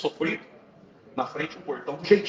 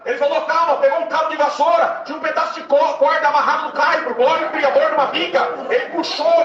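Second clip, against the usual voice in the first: much higher and louder, shouting the testimony; preaching at full force; 9 semitones higher, 7 dB louder than usual. Acted. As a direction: drive the story home to the congregation, shouting. (pregação gritada com fervor)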